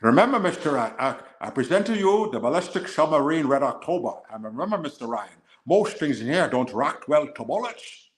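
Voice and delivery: slurred Scottish accent, deliberate pacing; put-on Scottish brogue, slowing toward the end; Sean Connery. Shown as a demonstration. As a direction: do a Connery-style Scottish movie line. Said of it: "That's more Sean Connery than, than Scottish".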